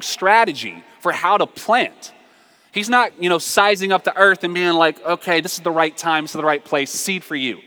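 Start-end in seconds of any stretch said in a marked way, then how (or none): none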